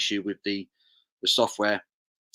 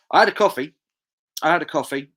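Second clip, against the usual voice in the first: emphatic; 6 semitones higher, 7 dB louder than usual. Real, not acted. Answering viewer, emphatic.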